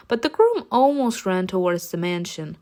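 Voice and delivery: usual speaking voice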